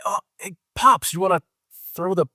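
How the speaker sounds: mock Disney-movie son, way up high; Disney movie kid. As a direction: play the corny sitcom son asking his pops. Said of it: "out of a Disney movie".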